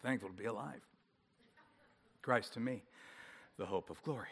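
low, soft, dragging mumble of the words; tired pastor mumbling his morning homework; sleepy recitation. Shown as a demonstration. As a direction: recite it half awake after a late night. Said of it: "now I got to do my own homework".

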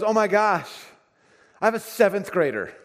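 put-on high, loud "oh my gosh" of a parent with a teen; mock dismayed parent. Preacher voices a parent of a seventh grader, high and loud in mock dismay.

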